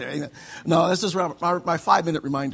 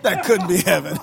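louder, laughing through it; laughing echo; 7 dB louder than usual. Retold or imitated. Pastor echoing Tamara's quip, laughing. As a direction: laugh as you repeat her line. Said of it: "Tamara's like".

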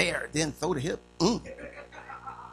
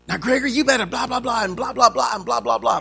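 higher, louder nagging mom; scolding mother; 5 semitones higher, 6 dB louder than usual. Retold or imitated. Mother scolding her son Gregory, scolding mother voice. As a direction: rattle off a mother's telling-off, pitched up. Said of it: "My mother used to go like this".

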